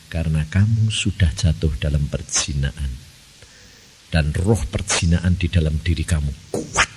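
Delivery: low, soft solemn voice; solemn God. Retold or imitated. God warning the pastor, low and solemn. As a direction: say it low and grave. Voicing God's solemn words.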